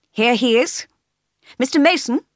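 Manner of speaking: lifted, ringing announcement; calling out. A narrator's reading. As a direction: call him forward from across the room. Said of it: "called the lawyer".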